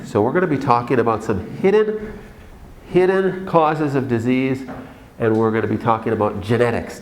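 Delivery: normal talking voice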